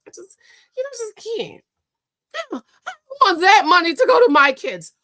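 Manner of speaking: loud fake-crying impression of the wife; sarcastic mock cry; mock whiny wife. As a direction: whine it like a greedy wife caught out. Mocking the wife's whine.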